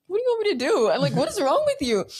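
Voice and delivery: high-pitched, exasperated retort. Retold or imitated. Sibling answers a panicking sister's plea, exasperated. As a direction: act out baffled impatience with a panicker.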